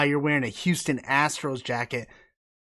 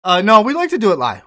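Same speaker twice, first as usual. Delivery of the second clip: usual speaking voice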